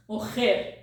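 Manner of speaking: Morrer with the rr as a throaty H, mo-her; Portuguese rr as H in Morrer; guttural R. Shown as a demonstration. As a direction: say Morrer with a rasping H in the middle. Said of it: "Here it says morrer".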